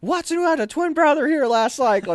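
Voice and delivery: loud, high-pitched imitation of a yell; hollered roll call, acted out; sergeant calling out. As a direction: summon a recruit across the formation.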